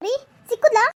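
high, loud call across a distance; anxious call. Acted. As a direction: shout to him, desperate for news. Wife calls to husband anxiously. (ಆತಂಕದಿಂದ ಜೋರಾಗಿ ಕೇಳುವುದು)

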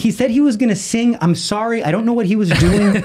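high-pitched, re-enacted excuses; flustered apology. Teacher apologizing to the girl after class, flustered.

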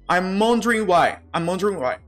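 I'm wondering why with wondering ending in ondi, no g; dropped g. Shown as a demonstration. Teacher shows the omitted g to students. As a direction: glide I'm wondering why without the final g sound. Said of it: "G aqui é omitido".

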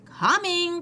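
high sing-out call; high storybook call back. A narrator's reading. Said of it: "called Amelia Bedelia".

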